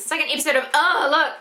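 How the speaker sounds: ordinary talk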